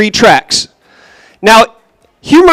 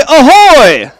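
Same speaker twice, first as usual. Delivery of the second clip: "ahoy!" called out to the room, falling at the end; called-out greeting; 2 semitones higher than usual. Shown as a demonstration. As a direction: call "ahoy!" across the crowd. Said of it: "Eyes this way".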